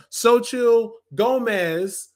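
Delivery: Xochitl Gomez said slowly, syllable by syllable; careful name pronunciation. Real, not acted. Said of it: "I've practiced how to say that name".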